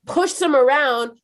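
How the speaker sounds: ordinary delivery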